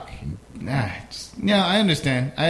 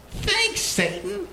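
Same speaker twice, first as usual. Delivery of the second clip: mock thanks; 8 semitones higher, much slower than usual. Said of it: "And you're like".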